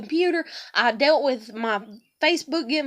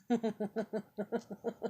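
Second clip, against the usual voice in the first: incredulous laugh; 3 semitones lower, 13 dB quieter than usual. Real, not acted. Reacting to being called crazy, incredulous laughter.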